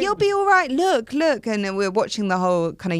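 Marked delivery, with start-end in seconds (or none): 0.0-1.5 high, sing-song reassurance; coaxing parent. Retold or imitated. Parent soothing the scared girl, high and sing-song. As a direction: soothe a frightened kid.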